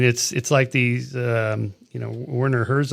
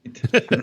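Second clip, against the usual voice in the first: high burst of laughter; 11 semitones higher than usual. Real, not acted. Laughs at accent joke.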